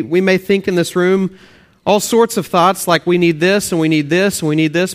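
usual speaking voice

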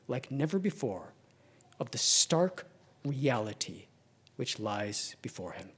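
ordinary talk, nothing marked

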